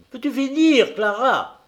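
high, loud scolding call; scolding mother. A narrator's reading. Mother scolding her children while cooking, high and loud. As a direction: snap at the children from the stove. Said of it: "grondait les enfants".

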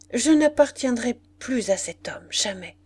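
strained vow ending hushed; altered, troubled voice. A narrator's reading. Julie renouncing her husband before Arthur, troubled voice. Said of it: "lui dit-elle d'une voix altérée".

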